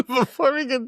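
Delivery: giggly retry; laughing. Real, not acted. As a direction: try again and still crack up. Retries the phrase, still laughing.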